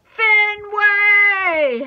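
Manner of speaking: long, high, loud call; drawn-out holler; yelling. A narrator's reading. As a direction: yell the dog's name, stretching it out. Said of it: "Hattie yells".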